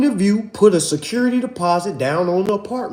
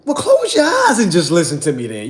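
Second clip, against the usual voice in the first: laughing. Real, not acted. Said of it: "own reply, laughing".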